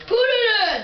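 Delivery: high shouted robber order; bank robber. Pretend. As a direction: bark it like a robber.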